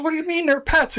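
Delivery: warden's voice. Retold or imitated. Warden questions the fisherman's pet trout, high and disbelieving. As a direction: sound baffled as the officer.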